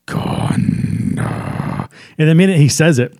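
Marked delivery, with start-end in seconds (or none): 0.1-1.9 breathy whispered Gondor; echoing whisper. Retold or imitated. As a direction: whisper it like a demon. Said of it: "it sounds like that too".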